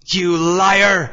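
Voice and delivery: loud, high outraged accusation; roar. A narrator's reading. Harold accuses Claire in front of police, roaring. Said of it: "roared Harold".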